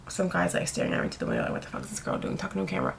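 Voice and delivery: hushed, quick muttering to camera; quiet aside. Real, not acted. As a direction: drop the voice for a private aside. Quiet aside about a watching stranger.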